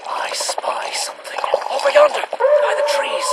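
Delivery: hushed whisper, rising on the trees. Acted. Hunter whispering to his crew about the beast. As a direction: tell them in a secret whisper.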